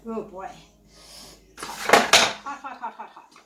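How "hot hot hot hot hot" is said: quick breathy whispers, stung by heat; hot, hot, hot. Real, not acted. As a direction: hiss it out like it burns. Handling the hot bread.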